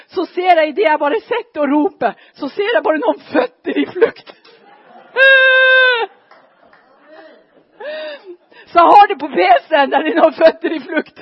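loud telling through laughter; laughing. Real, not acted. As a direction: crack up while telling it. Laughing at the fleeing feet.

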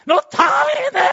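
high, loud battle scream. Shown as a demonstration. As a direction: scream it like a charge. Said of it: "jeg må skrike litt". (høyt skrik)

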